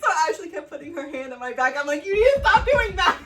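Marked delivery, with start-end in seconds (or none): 2.1-3.2 high, loud half-laughing cry; mock tearful plea.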